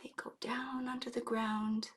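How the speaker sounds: soft hushed whisper. Acted. Telling children the meerkats settle down, whispering. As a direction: whisper like they might wake.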